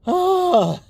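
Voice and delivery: sharp high ah from icy water; high loud shocked ah; cold gasp. Real, not acted.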